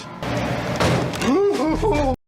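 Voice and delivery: a high, sharp gasp; real startled gasp; frightened gasp. Real, not acted. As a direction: gasp at a sudden noise. Another sudden noise.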